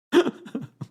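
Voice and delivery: sudden high laugh. Real, not acted. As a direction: crack up at the comment. Host laughs at chat comment.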